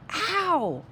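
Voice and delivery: high pained yelp; sharp cry of pain. Acted. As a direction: yelp as if stung. Boy stung, cries out.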